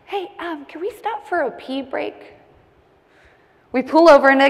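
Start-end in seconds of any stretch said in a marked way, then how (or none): 0.1-2.3 high, softened, careful ask; polite request. Retold or imitated. Woman to friends needing a pee break, politely. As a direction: ask meekly, holding the panic back. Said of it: "I politely ask".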